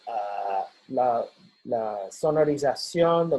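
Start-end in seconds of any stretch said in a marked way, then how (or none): none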